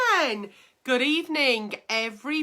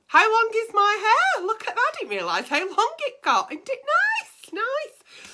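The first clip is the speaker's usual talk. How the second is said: camp excitement; 11 semitones higher, 4 dB louder than usual. Real, not acted. Admiring her own hair.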